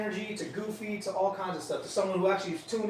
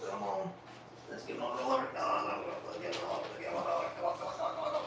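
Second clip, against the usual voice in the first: whispered, quick repeated "come on"; coaxing whisper; 6 semitones higher, 8 dB quieter, faster than usual. Real, not acted. Trainer urging his dog in a whisper, softly coaxing. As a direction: whisper it fast to urge the dog on.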